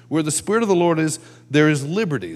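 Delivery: normal talking voice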